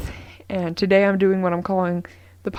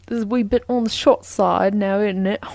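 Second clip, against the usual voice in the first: badly faked British accent; terrible British accent. Podcaster joking about a short episode, in a bad British accent. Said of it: "That was a terrible British accent".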